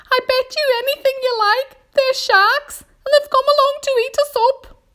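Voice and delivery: very high, loud character voice; panicky earthworm. A narrator's reading. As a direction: wail a dire warning in a high pitch. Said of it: "cried the earthworm".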